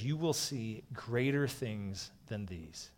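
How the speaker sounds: slowed right down, each word weighed; slow, solemn reading of a promise; slow solemn quote. Acted. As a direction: let the promise land slowly. Quoting Jesus, slowed for weight.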